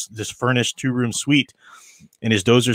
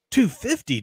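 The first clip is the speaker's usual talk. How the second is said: mock-exasperated Two fifty, pitched up; theatrical sigh; 9 semitones higher than usual. A narrator's reading. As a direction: play the disbelief a little theatrically. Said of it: "Dozer sighed theatrically".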